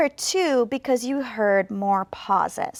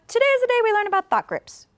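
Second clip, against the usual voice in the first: very fast and higher, run together; fast casual; 10 semitones higher, faster than usual. Shown as a demonstration. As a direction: rattle it off to family. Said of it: "speaking to my family very quickly".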